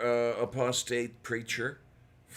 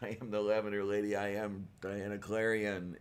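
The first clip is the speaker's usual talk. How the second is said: ordinary delivery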